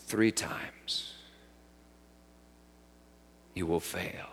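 whispered, fading away; hushed warning. Acted. Jesus warning Peter in a fading hush. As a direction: let the warning die to a whisper.

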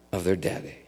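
ordinary talk

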